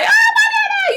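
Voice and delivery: very high-pitched mock panic cry; hammed-up hysterical squeal; going crazy. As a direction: imitate a frantic mother on the phone. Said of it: "you know, she going crazy".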